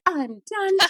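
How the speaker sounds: high theatrical announcement; dramatic. Retold or imitated. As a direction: declare it like a grand exit. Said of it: "Just so dramatically".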